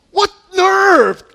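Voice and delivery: a sharp, high, loud cry of outrage; shocked disciples snapping at the brothers' nerve; indignant outburst. Retold or imitated. Disciples outraged at James and John, loud high indignant cry.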